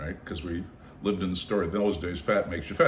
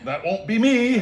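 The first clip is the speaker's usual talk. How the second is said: much higher, louder jittery protest; nervous joking; 13 semitones higher, 6 dB louder than usual. Retold or imitated. Hikers vowing not to be last, high nervous protest. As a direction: squeak it out, laughing nervously. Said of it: "We're like".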